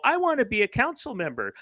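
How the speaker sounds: high, fast mock of a naive young activist; quick, high sarcastic impression; mocking eager student. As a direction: sneer it out like a starry-eyed kid. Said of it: "They go".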